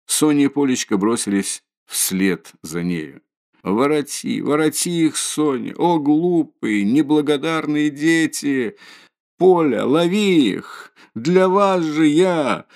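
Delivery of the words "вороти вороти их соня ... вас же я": high, slow, desperate wail; wailing and weeping. A narrator's reading. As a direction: cry out after the fleeing children. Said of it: "С воплем и плачем".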